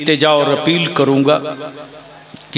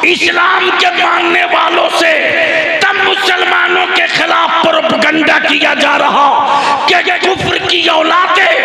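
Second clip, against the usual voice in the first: high, loud thundering sermon; preaching at full force; 13 semitones higher, 3 dB louder than usual. Acted. Preacher rousing the gathering, impassioned shouting. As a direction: hammer the point home to the crowd.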